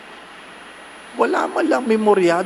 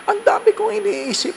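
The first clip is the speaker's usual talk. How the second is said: a higher, plaintive complaint; lightly voiced worried parishioner. Parishioner telling the priest she can't sleep, mock plaintive.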